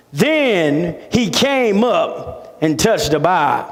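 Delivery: ordinary delivery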